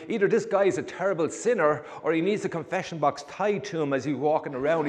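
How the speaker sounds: ordinary talk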